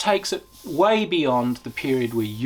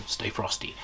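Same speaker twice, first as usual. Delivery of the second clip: soft whispered command; whispered order; 2 semitones lower, 10 dB quieter than usual. Retold or imitated. Commander telling people to keep calm, in a soft whisper. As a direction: hush it like a tense squad leader.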